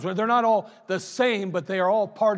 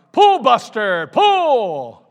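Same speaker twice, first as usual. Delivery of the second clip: loud, higher holler at the horse; farmer hollering; 4 semitones higher, 11 dB louder, much slower than usual. Acted. Farmer hollering a second name at his horse, loud. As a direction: holler the command across the field. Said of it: "the farmer hollered".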